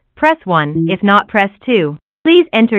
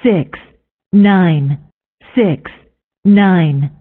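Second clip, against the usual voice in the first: spliced digit readout; 3 dB louder, much slower than usual. Acted. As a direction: separate recorded number clips, each falling. PIN digits read one by one.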